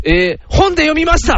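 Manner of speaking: high-pitched, hammed-up sincerity; nervous suitor. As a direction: answer the father like a flustered boyfriend. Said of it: "お父さん".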